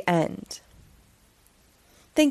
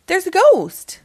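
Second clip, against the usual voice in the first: louder, higher alarmed report; frightened cry; 8 semitones higher, 7 dB louder than usual. Acted. An animal warns of the ghost, frightened cry. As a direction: blurt it out in fright.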